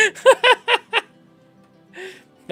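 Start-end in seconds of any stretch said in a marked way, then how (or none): 0.0-0.1 loud, giggling through the joke; can barely get it out for laughing. Real, not acted. Joke about oracle dust, laughing.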